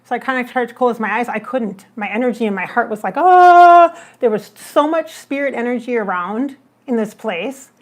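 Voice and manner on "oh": sudden loud startled "Oh!"; startled gasp. Retold or imitated. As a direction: jolt out a loud, high "Oh!" as if shocked. Heart jolting at spirit energy.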